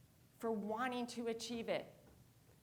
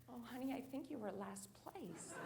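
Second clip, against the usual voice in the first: gentle mom; 11 dB quieter than usual. Retold or imitated. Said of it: "she looks at me and says".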